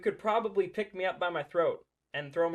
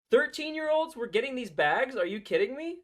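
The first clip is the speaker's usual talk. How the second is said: higher, louder indignant disbelief; outraged disbelief; 7 semitones higher, 5 dB louder than usual. Real, not acted. Reacting to the news about the gift bags, outraged and high. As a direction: sound appalled and incredulous.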